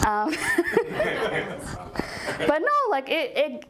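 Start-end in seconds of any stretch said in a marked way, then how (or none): 0.3-2.4 a breathy high burst of real laughter. Real, not acted. Laughing at her own retold beef.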